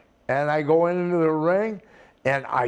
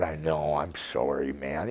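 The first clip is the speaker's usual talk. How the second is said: sincere apology; 11 semitones lower, 7 dB quieter than usual. Retold or imitated. Apologizing to rival.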